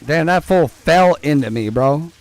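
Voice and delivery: ordinary delivery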